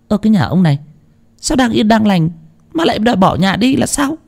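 high-pitched protesting wife; incredulous wife. Acted. (giọng bà vợ cao vút, ngạc nhiên trách chồng)

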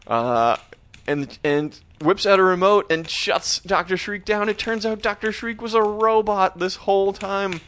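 ordinary talk

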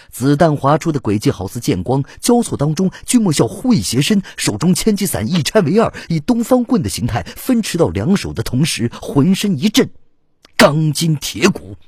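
high-pitched, driving battle narration; intense action narration. A narrator's reading. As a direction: narrate the fight blow by blow, urgently. Narrates fight at full intensity.

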